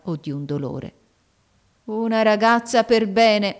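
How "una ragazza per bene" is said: loud, slow, scornful sneer; mocking exclamation. A narrator's reading. Maid mocking the girl's modesty, loud sneer. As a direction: throw her words back with scorn. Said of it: "esclamò con una smorfia da monello".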